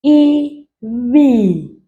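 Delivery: Ibizo stretched out very slowly; slowed way down. Shown as a demonstration. Teacher slowing the word for name. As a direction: drag out Ibizo syllable by syllable. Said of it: "I'll slow it down".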